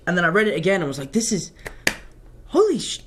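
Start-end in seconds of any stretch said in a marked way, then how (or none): 1.1-3.0 retelling a stunned, cut-off exclamation; shocked outburst. Retold or imitated. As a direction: voice jumps up high on a half-swallowed swear. Rereading news, cut-off swear.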